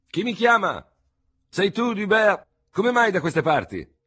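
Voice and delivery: high, loud call from a window. A narrator's reading. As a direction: shout down to someone in the street. Surgeon calls from window to street.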